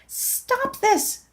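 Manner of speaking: high, strained cry of protest; internal scream. Acted. Narrator begging the stuck prayer to stop, high strained cry. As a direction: plead with the looping prayer to stop. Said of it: "I internally screamed".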